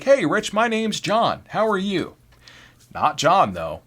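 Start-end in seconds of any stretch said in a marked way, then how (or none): 0.0-2.2 high-pitched, cheery hello; normal friendly introduction. Acted. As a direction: greet a new coworker like most people would. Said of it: "most people would just be like".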